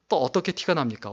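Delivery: ordinary talk, nothing marked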